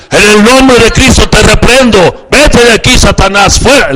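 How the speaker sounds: loud, high exorcism shout; commanding rebuke. Acted. Preacher acting out a rebuke of Satan, loud and commanding. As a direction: cast Satan out at full force.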